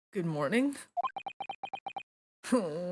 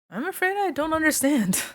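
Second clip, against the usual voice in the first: her line pitched up high; a young woman's puzzled reply; 10 semitones higher than usual. Acted. Iris replying to the gloomy detective, high-pitched and puzzled. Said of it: "I forgot to make her voice high-pitched".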